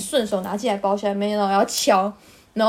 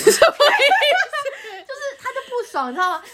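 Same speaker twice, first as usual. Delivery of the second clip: high-pitched laughing disbelief; 13 semitones higher than usual. Real, not acted. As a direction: laugh through the words. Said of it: "laughs while telling". (边笑边说，声音拔高)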